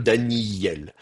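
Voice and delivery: "Daniel" with a long doubled y, Daniy-yel; lengthened yod. Shown as a demonstration. Teacher shows gemination, lengthened yod in "Daniel". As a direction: lean on the y in "Daniel". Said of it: "un allongement".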